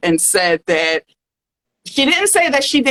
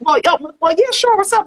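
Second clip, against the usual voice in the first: much higher, breezy diva voice; 8 semitones higher, faster than usual. Pretend. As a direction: play the pampered pop diva. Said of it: "[name], you wanna be [name] or [name]?".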